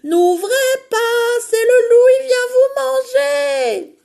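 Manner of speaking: very high, very loud sing-song yell; shouting down the chimney. Acted. Emma yells a warning down the chimney, very high and loud. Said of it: "crie par la cheminée".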